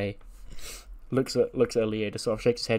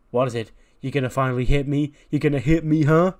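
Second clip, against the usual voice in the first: goading dare; 3 semitones higher, 6 dB louder than usual. Acted. Dom dares his father to finally hit him, taunting.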